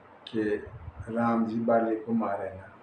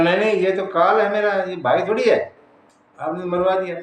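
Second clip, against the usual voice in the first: higher, louder, hurried protest; agitated complaint; 5 semitones higher, 3 dB louder than usual. Acted. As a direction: protest to the one who let you down. Acts Sugriv complaining after beating. (घबराकर ऊँचे स्वर में शिकायत)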